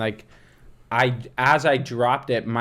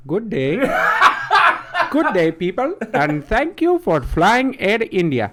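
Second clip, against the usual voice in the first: put-on higher, slow Indian-accent announcement; mock Indian accent; 7 semitones higher, 4 dB louder than usual. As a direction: play an Indian airline steward. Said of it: "Let's hear it".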